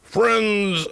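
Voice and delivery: slow, drawn-out radio pitchman; mock ad read. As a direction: parody radio endorsement. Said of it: "it's sort of like this".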